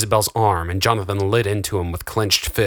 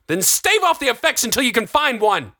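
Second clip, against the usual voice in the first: very high, desperate shout; strained, high-pitched insistence; heated demand. A narrator's reading. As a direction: demand it in outrage. Jonathan shouting back in argument.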